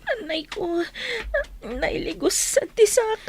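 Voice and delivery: crying in pain. Acted. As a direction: whimper over the twisted foot. Sprained foot after the fall. (agsangsangit gapu iti sakit ti saka)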